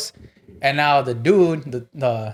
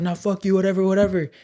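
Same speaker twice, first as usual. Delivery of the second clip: higher-pitched mock defiance; dismissive, pitched-up retold cursing; imitated drunk cussing; 6 semitones higher than usual. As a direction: mimic a drunk guy cussing people off. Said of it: "he starts cussing and shit".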